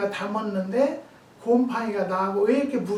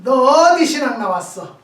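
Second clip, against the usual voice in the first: loud, contemptuous higher question; scornful challenge; 5 semitones higher, 11 dB louder than usual. Retold or imitated. Customer loudly challenging the farmer's seminary background. Angry caller challenging her.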